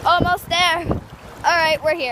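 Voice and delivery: loud, high and excited. Real, not acted. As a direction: call it out over the waves. Wading in cold water.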